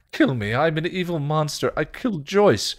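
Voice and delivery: high, loud self-loathing outburst; anguished Spike. Acted. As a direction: cry it out, desperate and high.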